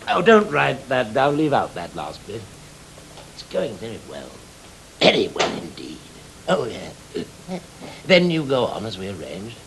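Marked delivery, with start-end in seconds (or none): none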